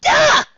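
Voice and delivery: shrill startled scream; high scream. Acted. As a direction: let out a piercing scream. Scream voiced.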